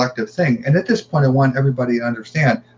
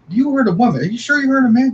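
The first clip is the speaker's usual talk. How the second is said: high, louder disbelieving questions; incredulous questioning; 12 semitones higher, 3 dB louder, faster than usual. Retold or imitated. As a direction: push back in disbelief, pitch way up. Retold disbelief at conflicting accounts.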